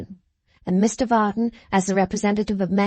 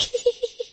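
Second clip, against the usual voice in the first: high spiteful giggle; 4 dB quieter than usual. A narrator's reading. Miggs sneering laugh.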